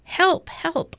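high-pitched cry for help; narrator's acted cry of alarm; shouted plea. A narrator's reading. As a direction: call for help as a scared story character. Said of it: "shouted Baby".

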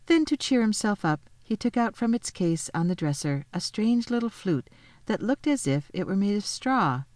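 usual speaking voice

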